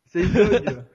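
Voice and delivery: laughing through the words; slow, laughing guess. Real, not acted. Laughing at own joke.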